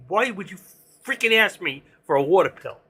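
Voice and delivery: indignant retold outburst, pushed up and loud; angry doctor. Doctor berating patient over a water pill request, angry. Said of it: "He got angry with me".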